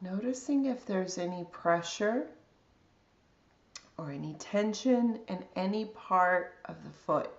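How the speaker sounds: calm, unhurried body scan guidance with long pauses; guided meditation. Real, not acted.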